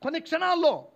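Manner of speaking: high, loud proclamation; forceful preaching. Real, not acted. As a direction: proclaim it to the congregation at full voice.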